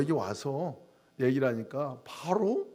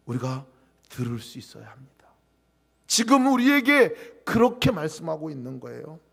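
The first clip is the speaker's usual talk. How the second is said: ordinary delivery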